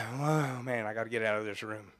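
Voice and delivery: low, quiet aside to himself; dropped-down, under-the-breath reaction; muttered dismay. Retold or imitated. Acting out smoke-filled room thought.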